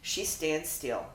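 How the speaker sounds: usual speaking voice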